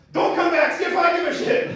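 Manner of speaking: high, strained mock yell at a kid; hammed-up angry father shouting. Acted angry dad yelling.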